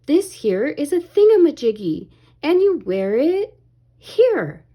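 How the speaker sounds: lightly voiced silly seagull; Scuttle declaring. A narrator's reading. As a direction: announce it like a proud know-it-all bird. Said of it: "he declared".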